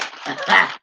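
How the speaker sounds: high, loud burst of laughter. Real, not acted. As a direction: crack up at your own impression. Laughs after the acted invitation.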